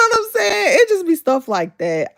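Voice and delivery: laughing through the words, voice up high. Real, not acted. Vlogger laughing at trolls, laughing high. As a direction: laugh it off as you talk. Laughing about rude commenters.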